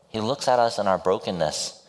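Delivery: ordinary talk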